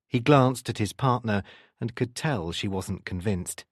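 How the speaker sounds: usual speaking voice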